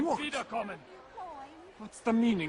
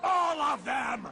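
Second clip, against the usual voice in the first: shout; 7 semitones higher, slower than usual. Acted. Commander yelling at his guard, slow shout. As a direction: yell it with each word hammered.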